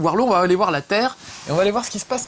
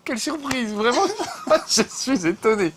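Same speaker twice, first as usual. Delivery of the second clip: laughing mock surprise; 6 semitones higher than usual.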